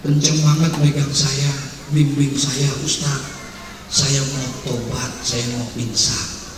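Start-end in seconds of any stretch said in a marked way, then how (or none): 2.9-6.5 slow, soft, humble plea; soft pleading. Acted. Young man begging the preacher to guide him, soft and slow. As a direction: beg quietly to be guided.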